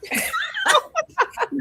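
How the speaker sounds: high-pitched real laughter; high, helpless giggle; amused laugh. Real, not acted. Laughing off the soup suggestion.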